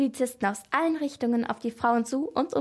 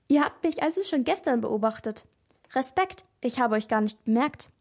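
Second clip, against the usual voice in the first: plain reading voice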